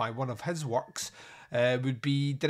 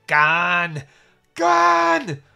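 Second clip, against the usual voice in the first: loud farmer's strained yell, leaping higher; 4 semitones higher, 12 dB louder than usual. Retold or imitated. Farmer heaving on a crowbar at the stone, yelling. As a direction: yell it out with full force.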